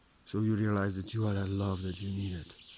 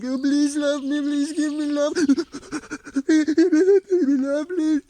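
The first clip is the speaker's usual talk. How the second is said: high, pitiful pleading imitation, much louder; mock begging; 9 dB louder, slower than usual. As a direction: mimic someone begging for love, pathetically.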